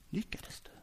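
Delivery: "Lyckades du?" breathed with no voice; whisper. Shown as a demonstration. Jesus wrongly imagined asking Petrus, whispered. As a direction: let it fade into a whisper. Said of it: "Är det så här".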